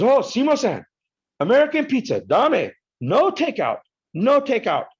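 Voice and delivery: put-on high, insistent Japanese-accented protest; mock Japanese waitress. The waitress protesting to the customer, high and insistent. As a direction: fuss at him that the pizza can't leave. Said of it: "the lady goes".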